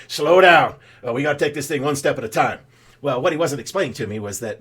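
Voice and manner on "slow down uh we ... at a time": hammed-up cowboy accent reining in an eager greenhorn. New Yorker mentor teasing an eager recruit, loud put-on cowboy accent. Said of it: "he threw in this, uh, you know, cowboy accent".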